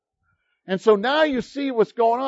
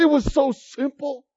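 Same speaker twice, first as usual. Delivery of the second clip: higher, rushed giddy amazement; giddy disbelief; 7 semitones higher, 3 dB louder than usual. Retold or imitated. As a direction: act out a man dizzy with joy. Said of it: "became giddy".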